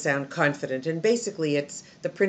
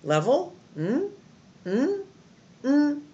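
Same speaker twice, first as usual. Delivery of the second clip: "Level" then each "mm" hummed high with raised pitch; high hummed "mm" steps, held up as if more follows; pitch pattern demo; 9 semitones higher, 3 dB louder, slower than usual. Shown as a demonstration. Teacher demonstrates the list's pitch, high hummed notes. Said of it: "So your pitch is like this".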